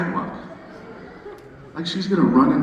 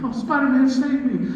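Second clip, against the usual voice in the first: high, breathless damsel cry for help; damsel in distress; 10 semitones higher than usual. Retold or imitated. Mary Jane tied up, calling to Spider-Man, in a high mock plea. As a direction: play a helpless captive calling her hero. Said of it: "she's screaming".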